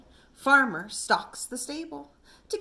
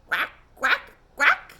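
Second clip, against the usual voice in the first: duck quacking; 4 dB louder than usual. A narrator's reading. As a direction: answer like a happy duck. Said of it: "She handed Little Fox".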